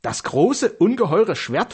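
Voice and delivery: high, astonished questioning. A narrator's reading. Wife asked to fetch the huge sword, astonished. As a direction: echo it in amazement, pitch lifted. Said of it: "frug sie erstaunt".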